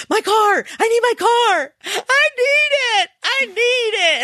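put-on high, wailing child shouting for his car; imitating toddler's panicked cry. Mother imitating her son wailing for his toy car. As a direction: squeal it like a panicking little boy. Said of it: "all of a sudden he'll go".

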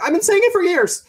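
high, loud exasperated cry; exasperated retort. Retold or imitated. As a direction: throw up your hands, I said this for years.

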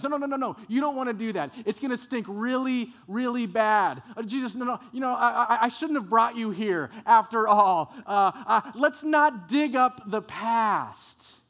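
high, loud, frantic protest; loud, high-pitched Martha, frantic and pleading; panicked Martha. Acted. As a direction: panic at the idea of opening the tomb. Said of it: "And Martha panics".

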